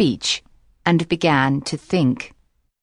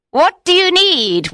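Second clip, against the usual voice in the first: much higher, louder witch call; high witch cry; 12 semitones higher, 4 dB louder than usual. Acted. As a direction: shriek the question at him. Witch calls again.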